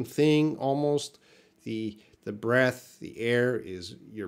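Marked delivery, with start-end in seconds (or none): none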